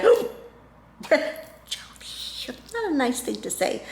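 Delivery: appalled acted whisper; whispered self-scolding. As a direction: whisper it as a telling-off to yourself. Acts scolding herself after blurting.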